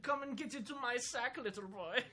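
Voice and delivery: high, hammy monster threat; Krampus voice. Acted. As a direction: menace a child as Krampus. Playing menacing Krampus.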